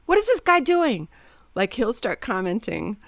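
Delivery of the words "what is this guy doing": high, loud, indignant mimicry; mock-outraged outburst; mimicked driver complaint. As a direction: imitate an exasperated driver griping at another car. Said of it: "behind a car and go".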